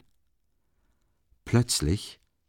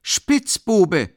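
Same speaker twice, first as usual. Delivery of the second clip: much higher, loud scornful cry; woman calling out; 11 semitones higher, 7 dB louder than usual. A narrator's reading. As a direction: hurl the insult. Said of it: "rief die Frau".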